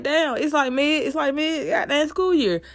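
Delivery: high, loud outraged cry. Retold or imitated. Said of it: "You be like".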